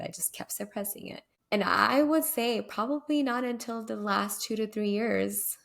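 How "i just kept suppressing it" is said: quiet repeat. Shown as a demonstration.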